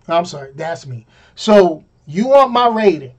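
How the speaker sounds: ordinary delivery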